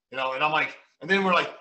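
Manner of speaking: ordinary talk, nothing marked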